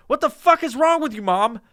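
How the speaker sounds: high, loud exasperated yell; yelling at mom. Retold or imitated. Son yelling at his hoarder mom, shrill outraged yell. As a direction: shriek it at her in disbelief. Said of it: "I'd be like".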